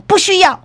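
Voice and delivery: 不需要 said high and emphatic; firm refusal. Retold or imitated. Manager again refusing to apologize, emphatic 不需要. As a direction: lean hard on 不需要. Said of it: "这店长佫讲".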